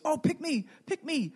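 high, eager, volunteering; a kid waving his hand to be chosen; eager pick me. Retold or imitated. Isaiah eagerly volunteering to God, high and excited.

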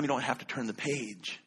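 ordinary delivery

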